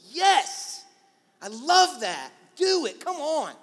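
loud, high-pitched burst of cheering; exclamation-point enthusiasm. Acted. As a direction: explode with support for a friend's idea. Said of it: "exclamation point people".

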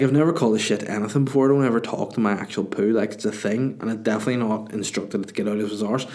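ordinary talk, nothing marked

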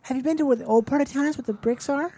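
keen neighborhood seeker. Pretend. One comic asks the others about the old part of town, mock-eager. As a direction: pitch it up as a mock-curious town visitor.

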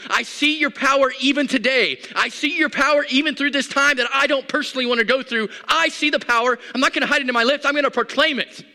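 high, loud fervent declaration; David's proclamation. Acted. David proclaims God's power amid grief, fervently.

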